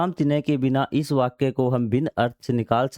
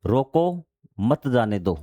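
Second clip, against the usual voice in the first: रोको raised and set apart, then मत जाने दो falling; pause after रोको; slower than usual. Shown as a demonstration. Teacher giving an easy comma example, pause after रोको. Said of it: "आपने comma लगा दिया".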